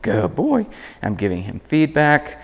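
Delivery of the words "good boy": high, singsong heel and praise; chirpy encouraging handler voice; upbeat dog talk. Shown as a demonstration. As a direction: keep him engaged, way up high. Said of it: "Notice I can slow down".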